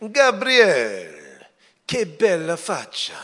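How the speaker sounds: put-on the barber Tito fondly greeting young Gabriel, Italian accent. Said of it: "my barber ... Italian guy's name was Tito".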